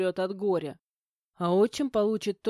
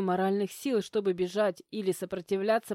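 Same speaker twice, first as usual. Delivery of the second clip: ordinary delivery, nothing marked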